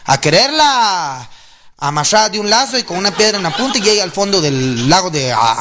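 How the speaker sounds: ordinary talk, nothing marked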